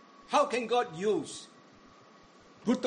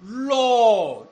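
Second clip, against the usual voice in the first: loud anguished call, much higher; crying out to God; 3 semitones higher, 12 dB louder than usual. Acted. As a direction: cry out the name to heaven. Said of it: "He cries out".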